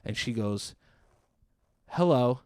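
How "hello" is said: loud, clipped "Hello." to shut him up; sharp cutting-off hello. Retold or imitated. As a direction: bark it to stop him mid-sentence. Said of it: "she fucking cuts me off with this".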